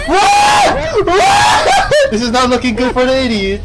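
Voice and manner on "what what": shrieking high what; horrified surprise. Real, not acted. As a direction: yelp it out in shock. Shocked at something on screen.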